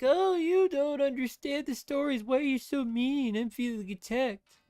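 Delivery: high, loud mocking whine; whiny writer. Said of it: "writers weren't such whiny".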